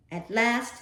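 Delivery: ordinary delivery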